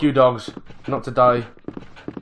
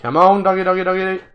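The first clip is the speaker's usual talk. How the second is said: higher, sustained sing-song dog call; 5 semitones higher, 3 dB louder than usual. Pretend. Gamer luring zombie dogs to kill, high sing-song call. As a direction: coax the doggy in a high, level chant. Calling game dogs to come.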